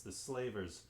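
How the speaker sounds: ordinary delivery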